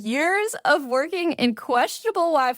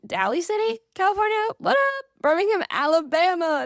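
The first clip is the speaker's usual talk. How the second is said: a shout-out that keeps building higher and higher; excited hype, climbing; 8 semitones higher than usual. Acted. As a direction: pitch climbing higher on each word, hyped up. Hyped repeat of listener town.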